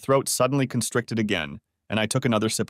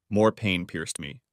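usual speaking voice

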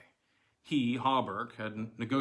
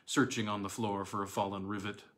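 ordinary delivery

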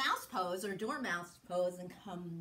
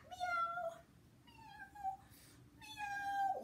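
very high, thin meows; playful cat mewing; 6 dB quieter, much slower than usual. Pretend. Teacher playing chased cat, very high little meows. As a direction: mew like a tiny cat.

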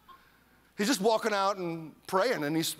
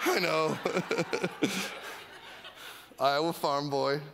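laughing; 3 semitones lower than usual. Real, not acted. Laughing at own accent slip.

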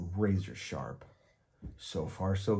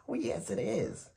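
much higher, silky monster greeting; a dainty monster greeting politely; 12 semitones higher than usual. A narrator's reading. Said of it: "high, silky voice".

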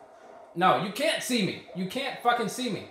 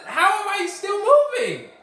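much higher, loud, slow; shocked outcry; 11 semitones higher, 8 dB louder, slower than usual. Real, not acted. Gamer still sliding on platform, shocked outcry. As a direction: cry out in disbelief.

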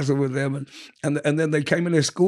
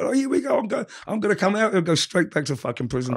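much higher, rising alarm; imitating panic; 6 semitones higher than usual. Caught with hash at customs, mock panicked. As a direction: blurt it out as the dread hits.

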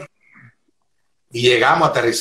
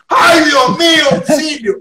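high, full-force panic scream; screamed cry for help; 8 dB louder, slower than usual. Retold or imitated. Driver pranks sleeping friend with fake crash, screaming for help. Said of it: "grité".

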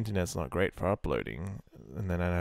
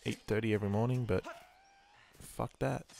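ordinary talk

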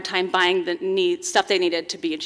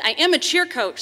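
projecting like a cheer coach at practice; booming coach energy, pitched high; loud cheer coach voice; 8 semitones higher, 5 dB louder than usual. Real, not acted. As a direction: call it out from the gym floor. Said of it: "so I have a loud voice".

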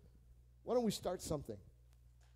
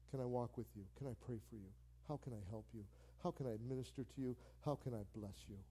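softer, gentle and caring; gentle offer; 3 semitones lower, 6 dB quieter than usual. Retold or imitated. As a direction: offer help tenderly. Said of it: "you know what they need? An arm".

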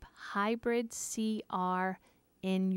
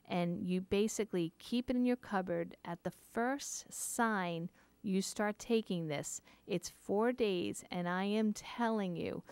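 ordinary talk

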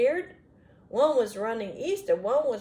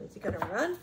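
ordinary delivery

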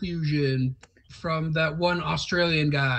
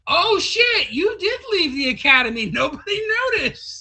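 mock astonishment; 11 semitones higher than usual. Friends discovering Bastion left the academy, mock astonishment. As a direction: act out a whole group's startled realisation. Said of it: "they're all like".

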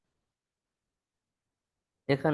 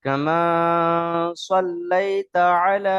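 slow, louder, long-held vowels; Quran-style recitation; 4 semitones higher, 8 dB louder, much slower than usual. Shown as a demonstration. Teacher modelling the next phrase for students, slow drawn-out recitation. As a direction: recite it slowly, stretching each long vowel.

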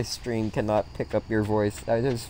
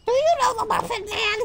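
tiny, squeaky cartoon fruit voice; Little Apple voice. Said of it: "I sounded like Midget Apple".

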